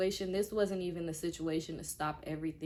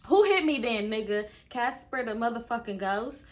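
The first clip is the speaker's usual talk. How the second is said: indignant outburst; 3 semitones higher, 7 dB louder than usual. Real, not acted. Storyteller firing back at the boyfriend's denial: loud and sarcastic. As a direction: call out the lie, loud and incredulous. Indignant retort to his lie.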